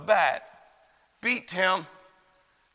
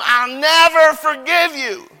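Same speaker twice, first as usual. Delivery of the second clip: high, loud, drawn-out scream; furious retold scream; 8 semitones higher, 8 dB louder, slower than usual. Widow to the guard who failed, furious scream. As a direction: scream it out slowly, full of fury. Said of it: "she screams at him".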